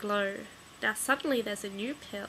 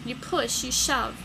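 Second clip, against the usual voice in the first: plain reading voice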